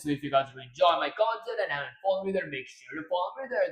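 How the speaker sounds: ordinary talk, nothing marked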